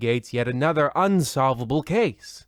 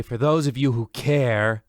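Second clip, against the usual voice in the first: ordinary talk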